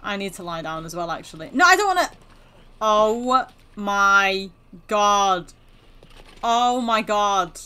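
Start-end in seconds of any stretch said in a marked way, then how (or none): 1.5-2.1 high, loud refusal; whiny protest. Pretend. Mock protest while gaming. 2.8-7.6 loud, drawn-out disbelief; shocked. Real, not acted. Shocked reaction to the game.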